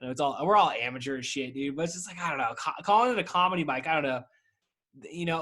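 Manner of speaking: ordinary talk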